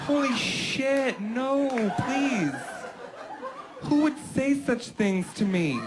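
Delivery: high, hammy fake terror; mock frightened. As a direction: overact a scared victim. Wife's overacted frightened reply.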